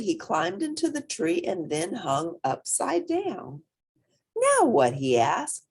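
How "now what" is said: high, loud hippo voice; eager little hippo. Acted. Portly hanging upside down asking the bat, high and impatient. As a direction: ask it impatiently as the young hippo.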